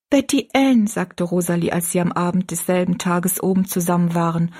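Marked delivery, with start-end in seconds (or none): 0.1-0.9 put-on high girl's call to a friend; young girl. A narrator's reading. Rosalie turning to her friend at night, higher-pitched. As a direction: address your friend in a raised girlish voice.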